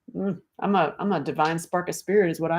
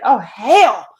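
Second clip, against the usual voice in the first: exasperated outburst; 4 semitones higher, 9 dB louder than usual. Retold or imitated. Exasperated self quote, loud burst.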